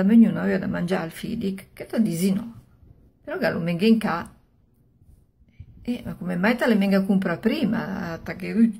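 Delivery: plain reading voice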